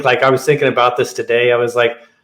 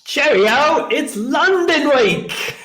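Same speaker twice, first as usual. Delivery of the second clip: jokey put-on British English accent, pitched much higher; terrible English accent; 14 semitones higher, slower than usual. Host greets co-host for London week, in a fake British accent. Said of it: "Enough with your terrible English accent".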